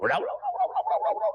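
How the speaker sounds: high trilled whirr for a fast getaway; imitated running noise. A narrator's reading. Storyteller mimics a man bolting off, high trilled sound effect. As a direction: rattle out a rapid high-pitched rolling noise.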